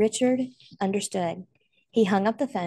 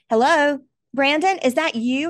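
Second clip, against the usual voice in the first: higher, louder, straining to be heard; urgent call on the phone; 7 semitones higher, 7 dB louder than usual. Acted. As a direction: call out anxiously over the line. Father answers phone amid fire.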